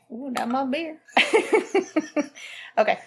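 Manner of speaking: normal talking voice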